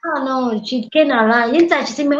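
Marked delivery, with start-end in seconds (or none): none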